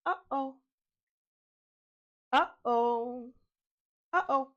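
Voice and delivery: Uh-oh with a clear catch of silence between the syllables; glottal stop. Shown as a demonstration. Teacher demonstrating a glottal stop to students, clean catch. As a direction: close the throat briefly between Uh and oh. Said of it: "The tiny catch in the middle".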